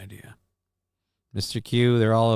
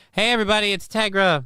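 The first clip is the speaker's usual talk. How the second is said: high cat intro voice; 9 semitones higher, faster than usual. Acted.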